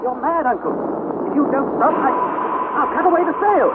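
begging uncle to stop in panic; panicked plea. Acted. Harry pleads with uncle to stop.

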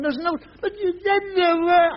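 put-on high, tearful boy's protest; child whining. Boy reproaching his mother, whining and tearful. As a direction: whine it like a wounded child.

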